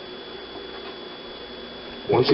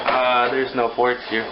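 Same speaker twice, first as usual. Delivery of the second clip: ordinary talk, nothing marked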